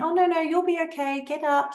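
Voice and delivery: high, quick, dismissive cheer; brushing it off. Shown as a demonstration. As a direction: hurry the child along. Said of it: "So we brush it off saying".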